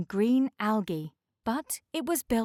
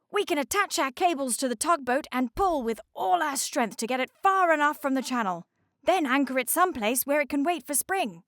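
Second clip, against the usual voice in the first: higher, brisk and fast; Castor the icebreaker; 6 semitones higher, 3 dB louder, faster than usual. Acted. Castor laying out the towing plan to the others, higher and brisk. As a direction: rattle off the plan with confidence.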